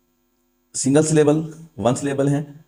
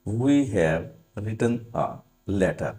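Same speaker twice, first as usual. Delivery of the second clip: very slow example sentence; slow dictation; 3 dB quieter, much slower than usual. Shown as a demonstration. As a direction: dictate each word clearly. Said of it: "dictating example slowly". (धीमे-धीमे उदाहरण लिखवाना)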